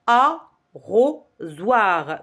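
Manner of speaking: ARROSOIR in three beats, a... rro... soir; slow arrosoir with gaps: a, rro, soir; syllable by syllable. Shown as a demonstration. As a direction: separate each syllable of arrosoir. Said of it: "le mot se compose de trois syllabes".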